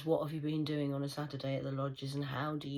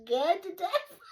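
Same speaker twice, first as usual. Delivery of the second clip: high, laughing shout of fake fear, slow; mock terror; 9 dB louder than usual. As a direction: ham up the fright while cracking up. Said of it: "I'm like".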